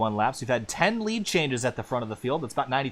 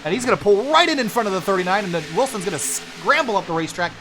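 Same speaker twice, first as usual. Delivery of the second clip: higher and louder, driving race call; live play-by-play; 6 semitones higher, 4 dB louder, faster than usual. Real, not acted. Commentator calling the pack battle after the restart, excited play-by-play. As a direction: ride the action as cars swap lanes.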